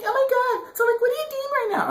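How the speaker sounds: put-on very high, fast valley girl squeal; high, quick mock girl chatter. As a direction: rattle it off like a bubbly teen girl. Girl's voice in retold chat.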